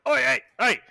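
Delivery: flustered British barman, pitched high; high, stammering pub landlord; stuttering bartender. Acted. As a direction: sputter in a put-on British accent. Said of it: "then immediately starts stuttering and is like".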